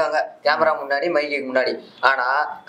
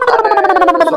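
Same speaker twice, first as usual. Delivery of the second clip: drawn-out shout; 9 dB louder, much slower than usual. Acted. Sudden drawn-out outburst.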